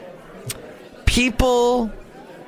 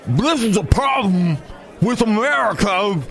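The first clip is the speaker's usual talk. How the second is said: lightly mocked radio host voice; as if swallowing his own lip, a little higher; mock talk-radio host, lip swallowed; imitating Mike Gallagher; 6 semitones higher than usual. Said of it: "He sounds like he's swallowing his own lip".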